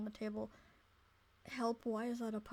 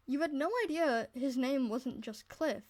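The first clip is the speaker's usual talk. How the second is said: ordinary delivery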